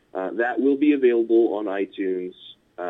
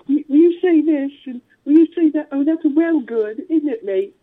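high-pitched mock London girl, louder; mock English accent; 7 dB louder than usual. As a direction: imitate the girls in a put-on English accent. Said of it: "they're just like".

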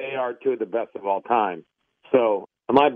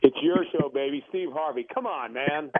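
ordinary talk, nothing marked

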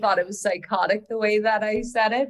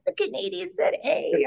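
teasing Americans; 9 semitones higher than usual. Retold or imitated. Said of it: "They're like".

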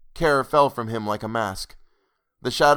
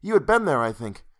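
eager; 7 semitones higher than usual. A narrator's reading. He answers eagerly.

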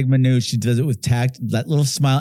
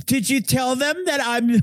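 high-pitched mock bragging mother; self-important mother; 11 semitones higher than usual. Film fan mimics the mother questioning her daughter, high mock self-importance. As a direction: put on a high voice, fishing for recognition.

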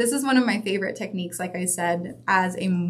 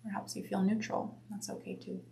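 guided meditation; 3 dB quieter than usual. Acted. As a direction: guide them gently inward. Leads a breath meditation.

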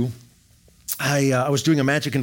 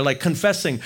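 normal talking voice

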